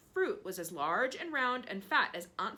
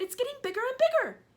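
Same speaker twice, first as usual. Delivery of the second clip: excited; 9 dB louder, faster than usual. Acted. Aunt amazed at growing peach.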